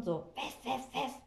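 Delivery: mock little dog barking; yappy poodle bark. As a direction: yap like a silly small poodle. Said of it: "kläfft so laut".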